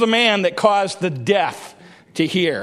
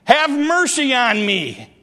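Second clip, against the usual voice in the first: calling out for mercy; 7 semitones higher than usual. Acted. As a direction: cry out, begging for mercy. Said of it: "The blind man starts to call out".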